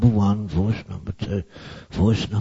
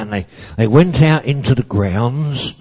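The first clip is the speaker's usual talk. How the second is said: plain reading voice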